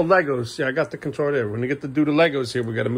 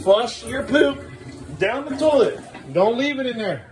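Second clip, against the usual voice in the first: high sing-song instruction; coaxing; 10 semitones higher than usual. Real, not acted. Dad instructing son to flush the toilet, high coaxing.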